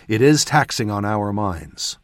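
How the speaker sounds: ordinary delivery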